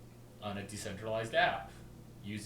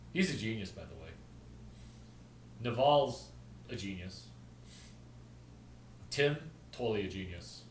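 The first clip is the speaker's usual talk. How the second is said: normal talking voice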